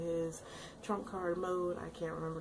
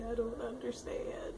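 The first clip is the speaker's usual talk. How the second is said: whisper; 5 semitones higher than usual. Retold or imitated. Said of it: "I was like".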